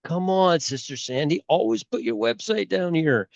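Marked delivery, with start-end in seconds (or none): none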